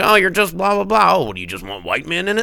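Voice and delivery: loud, high sneering mockery; mocking critics. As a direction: parody a whiny online critic. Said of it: "people critique these things, like".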